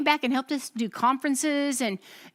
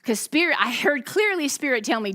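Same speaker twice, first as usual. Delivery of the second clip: ordinary talk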